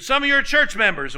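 high, loud mock yell. Woman yelling back at the pastor, imitated high shout. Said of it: "start yelling and screaming at people".

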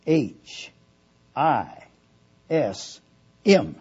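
letter by letter with long pauses; dictating. Shown as a demonstration. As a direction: spell it out slowly, one letter at a time. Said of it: "I'm gonna spell it".

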